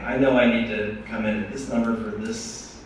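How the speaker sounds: ordinary delivery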